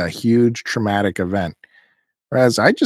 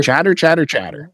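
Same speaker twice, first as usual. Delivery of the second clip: mock chatter; 5 semitones higher than usual. Mocking church chatter.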